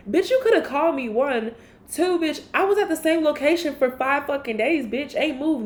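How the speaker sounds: high, loud indignant comeback; fed-up rant at a friend's excuse; heated rant. Real, not acted. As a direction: push it up and out, fed up.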